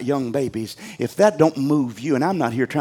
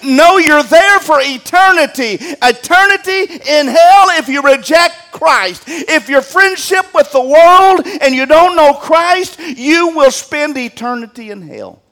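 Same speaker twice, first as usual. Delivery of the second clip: raised, louder hellfire preaching; preaching at full force; 13 semitones higher, 9 dB louder than usual. Acted. Pastor warns the congregation about hell, preaching hard. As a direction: drive each torment home to the congregation.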